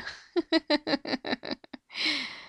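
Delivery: long chuckle in character; gunslinger's laugh. Acted. Gunslinger amused after reassuring good folk, drawn-out laugh. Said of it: "a gunslinging kind of voice like this".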